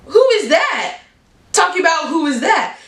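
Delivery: shocked and offended, pitch jumping up; indignant disbelief. Retold or imitated. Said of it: "And I'm like".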